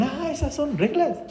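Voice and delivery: high, soft mock realisation; children realising. Los hijos descubren las reglas de casa, asombro imitado. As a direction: imitate kids suddenly catching on, quietly. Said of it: "Y los hijos dicen".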